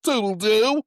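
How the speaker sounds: high, loud sing-song toodle-doo; [name]'s catchphrase as a mock diva goodbye. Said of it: "That was [name]".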